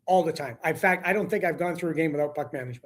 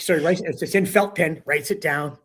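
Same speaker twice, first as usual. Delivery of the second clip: usual speaking voice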